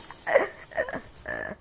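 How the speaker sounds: airy laugh. Real, not acted. Laughs at comeback.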